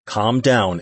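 usual speaking voice

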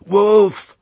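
high, loud shout of alarm; loud cry. A narrator's reading. Old sheep at the back of the flock, loud alarmed cry. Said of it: "more loudly than any".

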